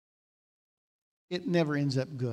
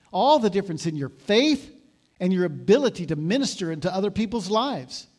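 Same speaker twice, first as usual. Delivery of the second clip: higher, louder impassioned preaching; preaching at full force; 4 semitones higher, 4 dB louder than usual. Acted. Pastor urging the congregation to accept correction, impassioned. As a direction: urge them to accept help.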